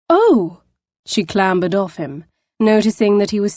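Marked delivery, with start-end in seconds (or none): 0.1-0.6 high, sudden oh; flustered surprise; startled gasp. Acted. Poppy startled to be lying on the Scot.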